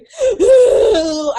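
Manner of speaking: high laughing gasp, half sob. Retold or imitated. Retelling therapy tears, a laughing sob gasp. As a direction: gasp like you're about to cry, laughing.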